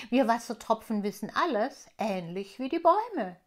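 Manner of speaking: lauter, erklärender Wassertropfen; Wassertropfen Aquarius. Acted. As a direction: ein Tropfen, der stolz alles weiß. (Aquarius erklärt dem Elfenmädchen die Welt, leicht gespielt)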